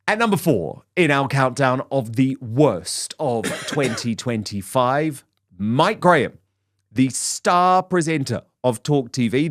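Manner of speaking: punchy presenter read; countdown announcer. Acted. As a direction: sell each item like a countdown host.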